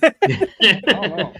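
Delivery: slow, loud exaggerated shrug; drawn-out mock ignorance. Real, not acted. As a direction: stretch it out like a clueless shrug. Drawn-out joking reply.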